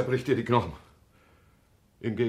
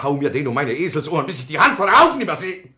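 high, loud desperate cry; loud, anguished shout; despairing shout; 5 semitones higher, 7 dB louder than usual. Acted. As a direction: throw it out in despair. Despairing angry outburst.

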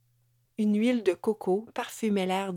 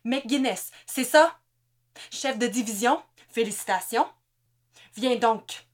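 menace narquoise; 3 semitones higher, 4 dB louder than usual. A narrator's reading. Hans menace l'otage, ironique.

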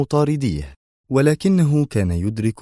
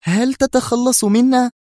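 very high, slow, agitated woman; tense, high-pitched challenge; nervous question; 4 dB louder than usual. A narrator's reading. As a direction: ask it on edge, drawn out and high. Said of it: "سألته منى في عصبية".

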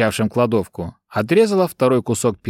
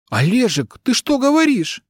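much higher, stunned cry of disbelief; shocked; 12 semitones higher than usual. Acted. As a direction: gasp it out in shock. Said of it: "потрясённо".

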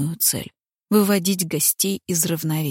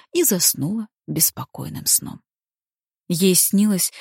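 ordinary delivery

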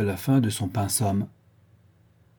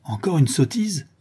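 feigned anger; 7 semitones higher, 3 dB louder than usual. A narrator's reading. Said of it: "s'écria le gouverneur, feignant une grande colère".

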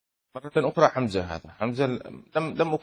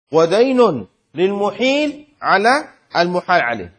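walk students through each word; 3 semitones higher, 6 dB louder than usual. Shown as a demonstration. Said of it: "خمسة". (شرح بطيء مشدد)

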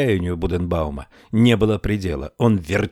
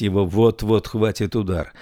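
ordinary delivery

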